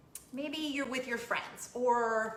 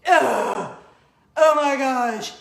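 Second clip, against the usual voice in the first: put-on loud, slow disgusted teenage whine with a laugh; teen groan; 12 dB louder, slower than usual. Mom imagining groaning at people on social media. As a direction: roll your eyes out loud. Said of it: "wanna just go".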